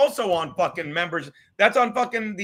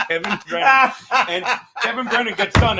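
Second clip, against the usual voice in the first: laughing; 2 semitones higher, 6 dB louder than usual. Real, not acted. Laughing retelling the story.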